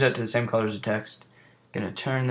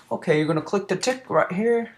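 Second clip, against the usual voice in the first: higher-pitched, badly faked British accent; shitty British accent; 9 semitones higher, 4 dB louder than usual. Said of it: "excuse me if my British accent is shitty".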